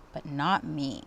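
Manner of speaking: usual speaking voice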